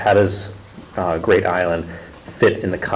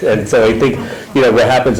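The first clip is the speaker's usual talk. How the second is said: laughing; 4 semitones higher, 6 dB louder than usual. Real, not acted. Laughs after Siri interrupts the talk.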